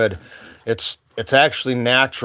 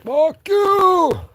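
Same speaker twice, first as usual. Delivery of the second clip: even higher, drawn-out mock yell; mock falsetto retort; 3 dB louder than usual. Musher yelling back at the townspeople, mock falsetto retort. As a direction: hoot it back in exaggerated falsetto. His retold reply to townspeople.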